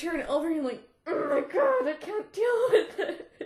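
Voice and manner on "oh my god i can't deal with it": overwhelmed outburst. Real, not acted. Reader's overwhelmed reaction.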